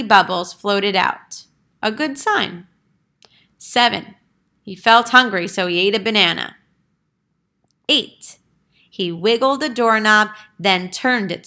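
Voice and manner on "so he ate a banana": usual speaking voice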